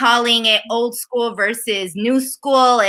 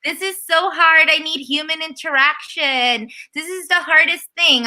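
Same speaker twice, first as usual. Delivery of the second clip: higher, hammed-up wailing complaint; mock whining; 5 semitones higher than usual. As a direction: wail about hardship in exaggerated self-pity. Mimicking the woman whining in quarantine.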